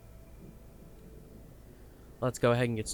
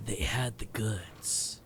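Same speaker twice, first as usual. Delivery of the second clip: slow whispered echo; whispering; 5 dB quieter, much slower than usual. Retold or imitated. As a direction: whisper it slowly back. Echoing the game line.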